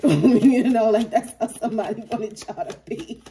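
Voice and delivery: words broken by loud laughter; laughing hard between words; laughing while talking. Real, not acted. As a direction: talk through the giggles. Laughing at her own skit.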